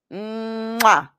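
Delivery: smacking air kiss; drawn-out mwah kiss; blown kiss. Real, not acted. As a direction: kiss the listeners goodbye. Blowing a kiss goodbye.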